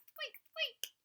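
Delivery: very high, whispered mouse squeaks. Acted. Frightened mice squeak on in a high whisper. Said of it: "the mice started to all squeak".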